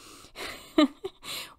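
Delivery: airy, voiceless chuckle; amused laugh. Real, not acted. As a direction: laugh at your own stubbornness. Laughs at own stubbornness.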